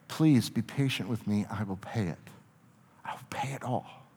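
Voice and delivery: soft, pleading beg, fading to a whisper; begging. A narrator's reading. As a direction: plead quietly, voice dropping away.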